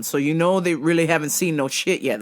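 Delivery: ordinary delivery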